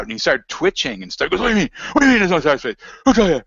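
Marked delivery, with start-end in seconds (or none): 1.4-3.4 alarmed, high-pitched demanding questions; freaking out. Retold or imitated. Stranger at the post office freaking out, alarmed high voice. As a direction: panic and demand to know who said it. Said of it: "He started freaking out".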